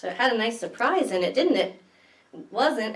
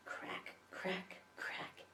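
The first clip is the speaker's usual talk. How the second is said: breathy whispered cracks; 3 semitones lower, 22 dB quieter, much slower than usual. Acted. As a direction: hush it like a secret. Whispered crack sounds in story.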